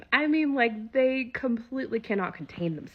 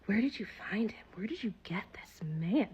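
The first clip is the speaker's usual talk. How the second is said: hushed whispered question; 6 dB quieter than usual. Retold or imitated. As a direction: lean in and whisper it. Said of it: "they were all asking me".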